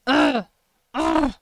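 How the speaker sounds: loud disgusted ugh; fed-up grunts; frustrated groan. Real, not acted. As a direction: groan in annoyance.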